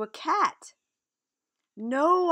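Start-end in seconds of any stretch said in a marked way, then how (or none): 1.7-2.3 high, loud protesting cry. Acted. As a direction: shout it out in alarm. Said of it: "I cried".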